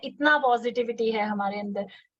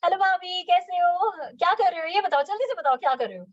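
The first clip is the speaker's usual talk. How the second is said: higher, eager and lively greeting; energetic; 5 semitones higher than usual. Shown as a demonstration. Calling bhabhi to build trust, higher and energetic. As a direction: sound thrilled to hear from her. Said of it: "अगर आप ऐसे बोलेंगे".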